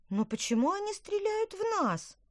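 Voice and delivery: put-on very high, girlish indignation; indignant question. A narrator's reading. Girl asks brother about the attack, indignant. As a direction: protest in a high child's voice. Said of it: "возмущённо спросила Лина".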